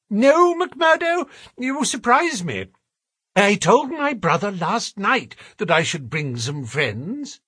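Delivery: high-pitched, put-out gentleman; protesting gentleman. Acted. Voices Thaddeus Sholto protesting.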